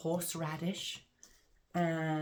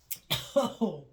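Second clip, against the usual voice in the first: sudden harsh cough; 4 semitones higher, 7 dB louder than usual. Real, not acted. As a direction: cough from a hot drink. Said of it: "that's a bit embarrassing".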